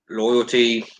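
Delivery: ordinary delivery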